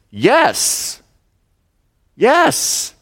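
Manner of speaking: high put-on "Yes", climbing on the second; petulant Jonah snapping back at God; sulky Jonah. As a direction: answer like a sulking prophet.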